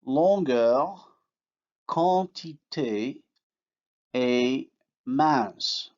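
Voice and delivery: longueur, quantité, et mince with unsounded nasals; slow French demo; French nasal vowels. Shown as a demonstration. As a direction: absorb the n into the vowel. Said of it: "in words like".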